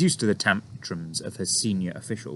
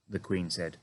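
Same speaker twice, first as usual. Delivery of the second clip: ordinary delivery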